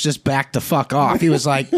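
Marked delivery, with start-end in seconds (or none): none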